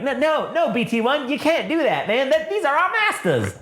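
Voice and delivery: high-pitched fussy robot protest; alarmed protocol droid. Acted. Droid stopping BT-1 from firing, high and flustered.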